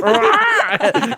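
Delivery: loud high yelp through laughter; laughing yelp. Retold or imitated. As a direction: yelp while cracking up. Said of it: "and went back".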